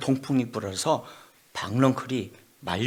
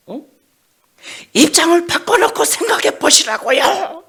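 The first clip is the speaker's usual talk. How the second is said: 바꿔놓고 shouted much higher and louder, rising; angry demand; 11 dB louder than usual. Acted. Jonah yelling at God to trade places. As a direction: yell it as an indignant demand.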